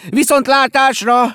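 high, slow, loud goodbye; drawn-out crowd farewell yell; shouted farewell. A narrator's reading. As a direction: call it out long and loud. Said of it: "kiáltották kórusban".

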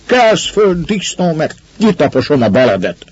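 high-pitched angry order; thundering threat. A narrator's reading. Master threatening the drunk lying in the hay, thundering. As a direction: threaten him up off the floor. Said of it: "dördült Reáz úr".

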